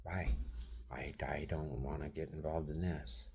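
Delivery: quiet imitation, almost a whisper; hushed quote. Imitating a relative refusing involvement, hushed whisper. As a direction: mimic her backing away, breathy and hushed. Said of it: "she's like".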